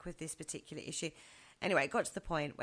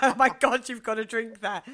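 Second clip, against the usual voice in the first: laughing mock protest; 4 semitones higher, 12 dB louder than usual. Mocking complaining housemates, laughing.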